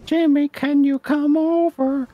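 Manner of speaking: very high, drawn-out cartoon moaning; Carl Wheezer impression. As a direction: stretch it out slowly in a high falsetto. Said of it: "moan like Carl Weezer".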